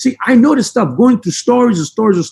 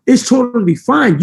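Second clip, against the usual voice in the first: normal talking voice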